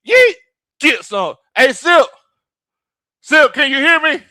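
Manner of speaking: loud, high-pitched playful shout; calling out. Pretend. Host to viewer up on the roof, shouting. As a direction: holler up to someone out of earshot.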